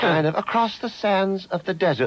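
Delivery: high, breathy-free swooning recital imitation; Barbara Cartland impression. Mocking Cartland's album for the host, in a high swooning recital. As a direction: recite it like a gushing romantic novelist. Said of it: "she recites stuff like".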